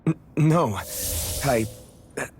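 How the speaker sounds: halting, embarrassed backpedal; flustered denial. Acted. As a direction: trip over your words, then brush it off. Caught out, stammers a denial.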